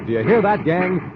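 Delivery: usual speaking voice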